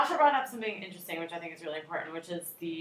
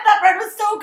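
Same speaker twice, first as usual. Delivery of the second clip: excited; 9 semitones higher, 8 dB louder than usual. Real, not acted. Raving about the bread.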